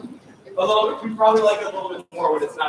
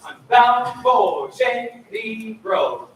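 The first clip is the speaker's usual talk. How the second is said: louder, bouncing notes; bouncy; 4 semitones higher, 7 dB louder, much slower than usual. Shown as a demonstration. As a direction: make each note bounce. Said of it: "Make 'em bounce".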